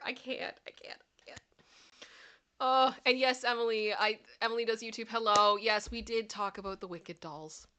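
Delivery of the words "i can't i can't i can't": fading out. Retold or imitated. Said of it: "I'm like".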